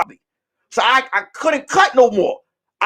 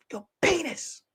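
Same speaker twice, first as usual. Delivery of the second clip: whisper; 5 semitones higher, 12 dB quieter than usual. Retold or imitated. Hiding, whispered.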